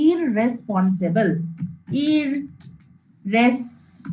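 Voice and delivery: Irresponsible low, soft and slow; slow soft demo word. Shown as a demonstration. Teacher breaking down a word, slow soft demo. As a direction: ease out irresponsible gently. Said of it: "তাহলে কি করে ভাঙছি দেখ".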